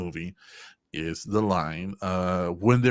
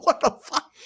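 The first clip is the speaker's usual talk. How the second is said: mock outrage. Mock author outrage continues.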